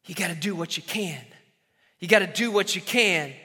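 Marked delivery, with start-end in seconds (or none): 0.0-1.2 low and soft, almost breathed; quiet sermon refrain; hushed refrain. Shown as a demonstration. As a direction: whisper the point first. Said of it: "repeated refrain".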